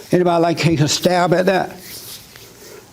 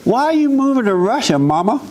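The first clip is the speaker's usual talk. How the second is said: higher, puzzled asker; questioning the mother; 7 semitones higher than usual. Retold or imitated. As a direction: quiz mama about her move, pitched up. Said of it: "someone questions the mother".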